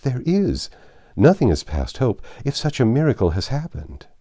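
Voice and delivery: mildly astonished, hope rising; amazed. A narrator's reading. Wife reacting to news the creditor may relent, amazed. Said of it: "she said, amazed".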